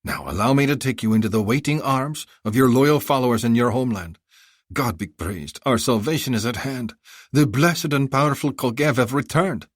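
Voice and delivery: high, effusive haggling vendor; a flattering bazaar seller pitching; eager merchant. Acted. Vendor reverent.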